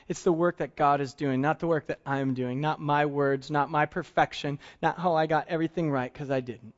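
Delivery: ordinary talk, nothing marked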